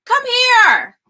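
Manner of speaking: high, real call across the house. Real, not acted. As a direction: call your son into the room. Mom calling her son over.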